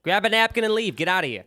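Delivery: loud, brusque mock Boston shop patter; mock Boston accent. Sub shop worker sending customers off, mock Boston accent. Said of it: "why I would use that accent".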